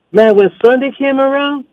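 high, breezy put-on voice; mock Cliff voice. Cliff telling the caller games were easy, high mock voice. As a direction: toss it off lightly as Cliff. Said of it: "He goes".

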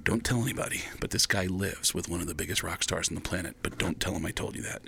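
low, soft conspiratorial aside; hushed secret. Retold or imitated. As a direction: lean in and keep it secret. Friend whispers a secret introduction.

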